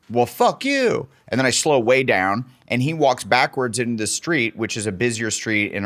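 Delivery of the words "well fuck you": high mock snotty retort; hammed-up teen comeback; kid talking back. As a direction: fire it back like a bratty teen. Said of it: "And I go".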